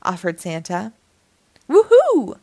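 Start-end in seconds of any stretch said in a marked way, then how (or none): 1.7-2.4 very high, loud whoop; excited shout. A narrator's reading. Max shouts at the promise of mummies, whooping excitedly. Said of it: "shouted Max".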